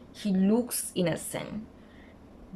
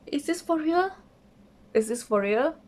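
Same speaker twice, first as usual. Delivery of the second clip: incredulous; 7 semitones higher than usual. Retold or imitated. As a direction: ask it twice in rising disbelief. Her disbelieving reaction, retold.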